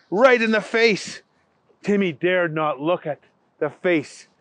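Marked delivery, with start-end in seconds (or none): none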